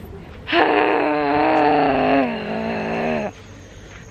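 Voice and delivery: long loud sigh; heavy exasperated sigh; frustrated sigh. Real, not acted. Package delayed, frustrated sigh.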